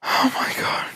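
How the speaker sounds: breathless whispered oh my god; barely a breath, overcome; stunned whisper. Real, not acted. Overwhelmed streamer, near tears.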